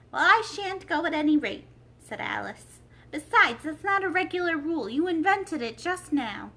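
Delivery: high, loud defiance; defiant Alice. A narrator's reading. Alice refusing to leave the court, high and loud. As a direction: refuse flatly and loudly.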